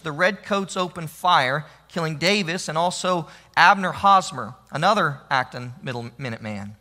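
usual speaking voice

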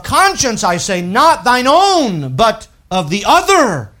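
loud, high scripture proclamation; preaching at full force. Acted. Preacher reading chapter ten aloud, loud and ringing. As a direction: read it out like a herald.

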